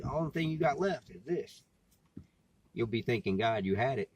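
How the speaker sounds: ordinary talk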